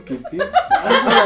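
squeaky, slowed "¿Qué te pasa?" through laughter; laughing high-pitched quote of sister. Retold or imitated. Retelling the sister's reaction, laughing high and slow. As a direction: mimic the sister while cracking up.

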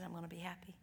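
a soft tender whisper. Retold or imitated. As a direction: breathe it as a quiet promise. Tender words to dying father.

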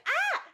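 very high, short squeal of delight. Retold or imitated. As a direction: squeal like a fangirl at the couple. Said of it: "and I'm like".

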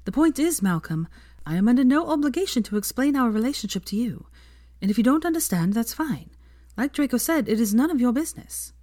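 a hint of a firm young witch; Hermione voice. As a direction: speak up a little, firm and composed.

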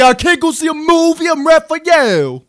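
Raphael impression. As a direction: lean hard into the gruff teen. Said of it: "That's a spot on impression".

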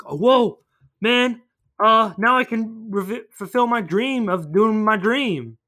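lightly hammed-up awe; mock amazement. Villains' awed reaction, retold.

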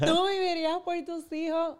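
ríe mientras repite la pregunta; laughing repeat. Real, not acted. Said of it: "Puf, punchline". (pregunta repetida entre risas, más aguda)

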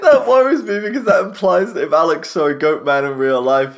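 ordinary talk

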